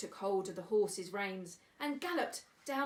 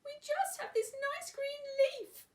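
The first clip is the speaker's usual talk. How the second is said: very high, slow ant voices; tiny ants; 4 dB louder than usual. Acted. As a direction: squeak it like a tiny insect. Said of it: "the ants replied".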